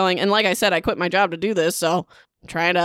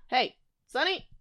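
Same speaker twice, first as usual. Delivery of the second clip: softer first call, rising at the end; soft questioning call; calling a pet; 4 semitones higher, 7 dB quieter than usual. Real, not acted. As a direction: call the pet over gently, away from the mic.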